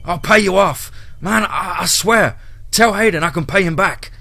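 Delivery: loud, high, desperate bargaining; panicked pleading. A narrator's reading. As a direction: beg loudly for your life. Panicking Brady bargains at the window.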